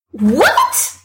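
high startled what; shocked cry. A narrator's reading. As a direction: gasp it out in shock. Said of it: "she cries".

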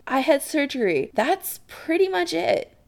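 high-pitched disbelief; incredulous. Acted. Chris correcting Adam about his past, incredulous. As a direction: state it as if amazed it needs saying. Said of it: "Chris says incredulously".

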